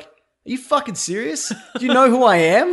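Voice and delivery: 3 scoff like an insulted superhero; 1 indignant hero. Retold or imitated. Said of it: "Aquaman's just like".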